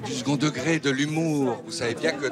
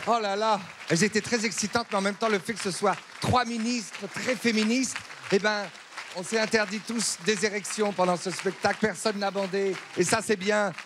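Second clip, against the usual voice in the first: high-pitched, overexcited gushing; breathless fan after the show; gushing congratulation; 8 semitones higher than usual. Acted. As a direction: heap on praise in a raised, thrilled voice. Gushing congratulations to three ministers.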